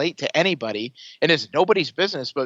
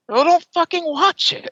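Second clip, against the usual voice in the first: much higher and louder, fed up; exasperated outburst; 7 dB louder than usual. Real, not acted. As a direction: blurt it out, fed up with the whining. Exasperated retort to complainers.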